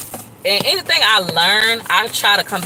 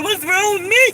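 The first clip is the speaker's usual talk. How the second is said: high, whiny pleading question; mock despair; 12 semitones higher, 3 dB louder than usual. Complaining to viewers about never marrying, high whine. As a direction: ask it in a high fake-pitiful whine.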